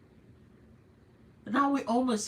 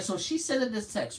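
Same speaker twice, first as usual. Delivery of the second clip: normal talking voice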